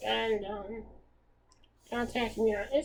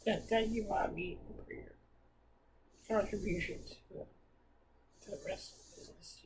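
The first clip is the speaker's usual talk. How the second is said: choked-up near whisper, softer; fighting back tears; teary; 6 dB quieter than usual. Real, not acted. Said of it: "I got a little teary out there".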